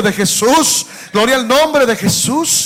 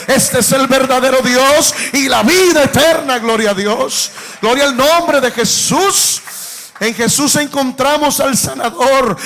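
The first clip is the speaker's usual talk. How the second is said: louder, climbing proclamation building to a peak; full-force preaching, almost shouting; rising preacher's fervor; 2 semitones higher, 4 dB louder than usual. Acted. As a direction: lift the church up with each phrase.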